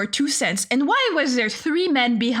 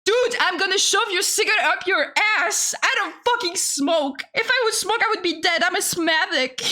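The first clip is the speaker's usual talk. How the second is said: high-pitched, indignant mock rant; retold telling-off, pitched up; exasperated retort; 6 semitones higher than usual. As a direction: fire back in comic exasperation. Retold angry retort to cigarette beggar.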